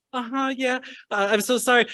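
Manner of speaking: high-pitched apologetic reply; sheepish English apology. Retold or imitated. Student apologizing to professor in English, high sheepish voice. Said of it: "in English, it would come out as like".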